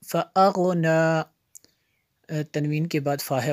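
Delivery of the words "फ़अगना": measured, resonant teaching recitation; word-by-word recitation. Shown as a demonstration. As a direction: chant each word separately with held vowels. (एक-एक लफ़्ज़ लय में पढ़ना)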